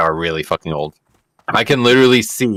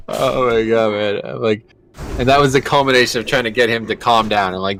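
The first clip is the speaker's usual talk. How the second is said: usual speaking voice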